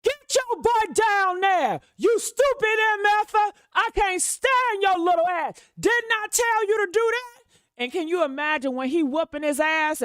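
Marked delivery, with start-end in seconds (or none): 0.0-7.4 mock furious father yelling; imitating yelling father. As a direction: belt it out like a furious dad scolding his son.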